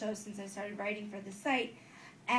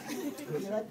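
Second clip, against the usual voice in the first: ordinary talk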